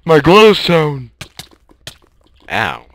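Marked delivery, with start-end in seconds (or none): 0.0-1.1 loud, high, slow wail; anguished shout. Real, not acted. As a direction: cry out in dismay. Loses item in game.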